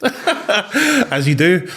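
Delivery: slow, high words through a laugh; amused at his own wild past. Real, not acted. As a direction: let the laugh carry the words. Laughing about his partying years.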